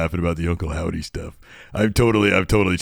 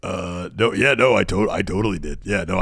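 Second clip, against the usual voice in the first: normal talking voice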